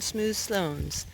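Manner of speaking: usual speaking voice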